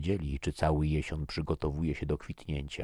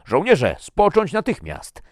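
very loud, high shouted order; thundering command; 13 dB louder than usual. A narrator's reading. Captain orders squabbling armed soldiers to stop, thundering. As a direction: thunder at the troops to stand down. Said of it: "zagrzmiał Svensson".

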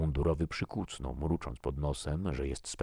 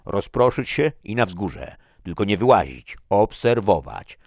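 loud, raised command voice; barked orders; 5 semitones higher, 12 dB louder than usual. A narrator's reading. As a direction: issue firm orders to the whole group. Officer gives orders to group.

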